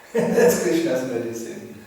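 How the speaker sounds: ordinary talk, nothing marked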